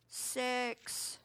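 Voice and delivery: usual speaking voice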